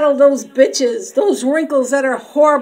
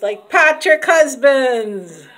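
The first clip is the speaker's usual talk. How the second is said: usual speaking voice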